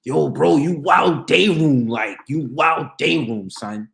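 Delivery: loud, slow mock bro call-out; imitating a relative. Relative teasing him about being wild, slow loud mock call. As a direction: drawl it out loud like an older cousin teasing. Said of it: "They be like".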